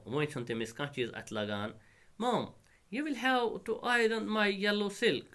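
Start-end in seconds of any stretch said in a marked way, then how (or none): 2.2-5.3 high-pitched, drawn-out daughter's demand; put-on high voice of a bossy daughter; demanding spoilt daughter. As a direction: pitch it high and spell out the order slowly.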